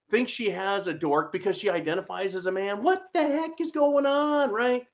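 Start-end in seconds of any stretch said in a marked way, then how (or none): 2.8-4.9 exasperated disbelief. Real, not acted. Exasperated aside.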